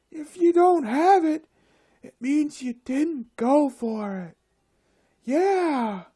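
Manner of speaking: high, loud, airy preachy voice with whooshes; mocking windy people. Mocking the windy individuals who say it's all there, high hammed-up voice. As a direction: ham up the breezy go-getters, pitched high. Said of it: "I'm gonna stop talking like that".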